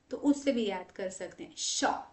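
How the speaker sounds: ordinary delivery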